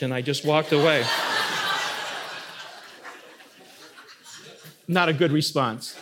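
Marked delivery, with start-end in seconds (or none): 1.1-2.7 a short airy laugh at himself; breathy laugh. Real, not acted. Pastor laughs at his old reply with a soft breathy laugh. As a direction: laugh under your breath, embarrassed.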